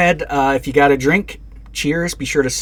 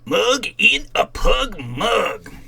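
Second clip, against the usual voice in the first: drawn-out sing-song; 9 semitones higher, much slower than usual. Shown as a demonstration. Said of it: "repeats the product name".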